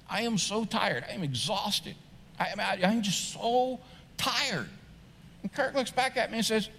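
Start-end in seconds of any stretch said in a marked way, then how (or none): 0.1-4.7 softer, weary whine, dragging out the last words; worn-out complaint. Acted. Preacher acting out telling his friend Kirk he's exhausted, weary. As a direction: play up being exhausted, slow down on "so tired".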